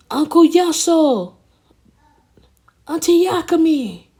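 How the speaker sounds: loud, high-pitched calls for missing people; high searching shout; calling out names. Acted. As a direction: shout each name out high, drawing out the end. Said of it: "he starts calling out names".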